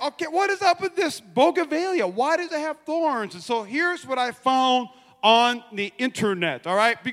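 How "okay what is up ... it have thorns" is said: high baffled self-quote; puzzled, voice jumping up. Retold or imitated. Pastor recalls puzzling over the thorny plant, high and baffled. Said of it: "I was like".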